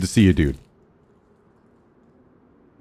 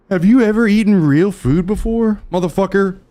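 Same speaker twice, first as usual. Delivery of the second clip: mocking whiny voice; 7 semitones higher than usual. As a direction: ham up a snooty critic. Mocking chat's imagined question.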